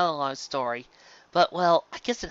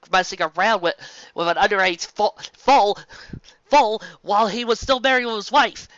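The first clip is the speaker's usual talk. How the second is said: loud indignant rant, pitched up; angry outburst; 6 semitones higher, 6 dB louder than usual. Real, not acted. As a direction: vent real anger at the jerk. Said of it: "I really hate that jerk".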